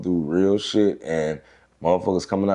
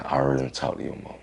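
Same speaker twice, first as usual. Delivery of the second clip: quick aside; 5 semitones lower, 6 dB quieter, faster than usual. Retold or imitated. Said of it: "She said".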